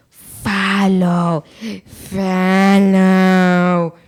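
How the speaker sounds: drawn-out ghostly beckon; ghostly call. Retold or imitated. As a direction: lure him with a lingering spectral call. Ghostly voice calling Ichabod.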